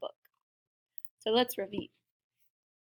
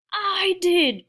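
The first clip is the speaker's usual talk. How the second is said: loud, high boastful bark; 8 semitones higher, 7 dB louder than usual. A narrator's reading. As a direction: bark it down from above. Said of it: "barked a voice from above".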